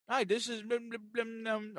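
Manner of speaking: high, garbled mumbling agent; mock an agent mouth full of food; mumbling through food. Mocking the agent who chewed while she answered, garbled. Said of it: "couldn't understand a word".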